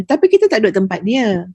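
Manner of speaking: ordinary talk, nothing marked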